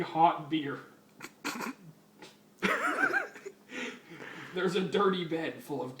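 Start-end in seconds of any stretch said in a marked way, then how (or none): 2.6-3.5 a sudden high squeaky giggle. Real, not acted. Reader losing it over the beach orgies, high giggle. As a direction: break into a high giggle, falling off. Reader breaks up mid-story.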